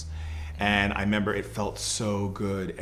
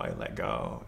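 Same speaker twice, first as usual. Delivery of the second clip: breathless whisper, much lower and softer; whispered release; 8 dB quieter than usual. Real, not acted. Guest reliving her death to the host, whispered. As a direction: let it out on a whisper as you surrender.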